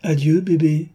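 a mocking, patronizing goodbye; patronizing farewell. A narrator's reading. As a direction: toss it off like a protective elder. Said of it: "cria d'un petit ton protecteur".

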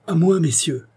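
rallying cry to companions; calling for help. A narrator's reading. Isidore calls the gentlemen to help, calling out. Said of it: "dit Isidore en sautant en bas de son cheval".

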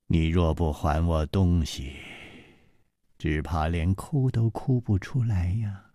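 cold threat. A narrator's reading. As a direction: threaten quietly, coldly. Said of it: "那个女子冷冷地说".

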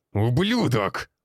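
yelling an insult; furious shout. A narrator's reading. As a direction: scream it in rage. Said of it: "прокричал Карпов Андрей Артёмович". (яростный крик)

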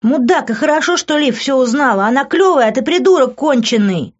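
put-on high, scolding young woman's voice; Demi swearing. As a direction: tear into him as the furious girlfriend. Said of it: "ругалась Деми".

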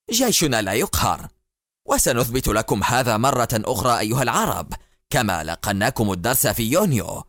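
plain reading voice

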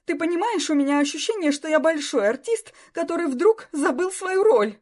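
a nervous star losing it completely; hysterical. Acted. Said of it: "закатил истерику".

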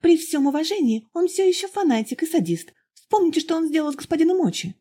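put-on high, eager young woman pouring out her story; young girl's voice. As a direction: pitch it up high, breathless and earnest. Girl's voice continues. (высокий взволнованный девичий голос)

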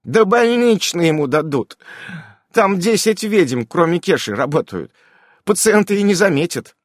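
high, brisk witch voice; a busy witch promising a cure; high lively witch voice; witch Irene voice. A narrator's reading. Irene dismissive.

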